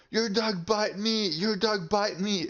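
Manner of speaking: high-pitched mock complaint; imitating angry accuser. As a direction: mimic an aggressive man's high, insistent accusation. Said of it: "he screams, starts saying that".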